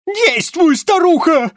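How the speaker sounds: very high, loud barked order; harsh command. A narrator's reading. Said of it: "уже гораздо жёстче приказал".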